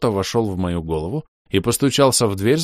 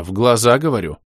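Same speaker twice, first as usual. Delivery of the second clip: louder, higher, insisting; insistent raised voice; 4 semitones higher, 6 dB louder than usual. Acted. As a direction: snap at yourself to look up.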